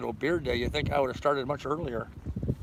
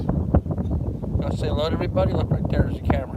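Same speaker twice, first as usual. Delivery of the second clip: coaxing dog to camera; 8 dB louder than usual. Real, not acted.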